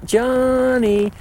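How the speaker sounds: high, drawn-out call falling off; lost call. Shown as a demonstration. Demonstrating the lost calf call, high then falling. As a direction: hold it then let it fall at the end. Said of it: "and falls off at the end".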